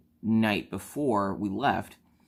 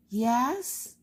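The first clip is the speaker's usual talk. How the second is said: put-on child voice; 14 semitones higher than usual. Daughter voiced in story.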